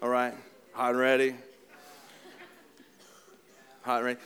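a hint of the pizza commercial pitchman; Little Caesars ad voice. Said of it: "You guys know hot and ready's".

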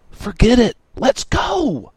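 high loud urgent yell. A narrator's reading. Lantis yelling at Bowman to leave the spaghetti. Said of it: "Lantis yelled".

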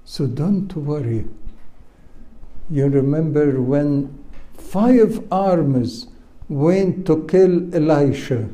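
normal talking voice

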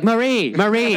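high, calling a name across the table; mom calling out. Retold or imitated. Mom blurts her own middle name to the rep, high and eager. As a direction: mom answers the security question eagerly. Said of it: "And she's like".

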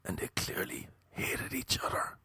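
hushed, breathless whisper with no voice at all. Retold or imitated. Two rivals quarrelling in a coffee shop, whispered. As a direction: whisper it like two men arguing quietly in a café. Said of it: "doing it all like this".

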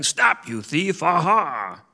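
high startled shout; spring out and yell. A narrator's reading.

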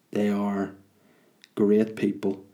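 ordinary talk

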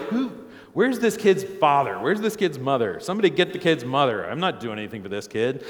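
loud, mock-indignant demands; indignant disowning. Man faced with stranger's screaming kid, loudly indignant. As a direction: call out in exaggerated refusal to take charge. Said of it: "I'm gonna be like".

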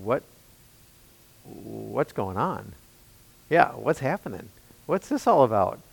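bewildered murmur. Retold or imitated. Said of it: "standing there going".

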